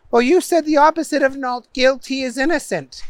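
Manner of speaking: high, quick comeback; argumentative Booger. A narrator's reading. Defendant quibbling with the judge, high and quick. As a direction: argue back quickly in a high voice.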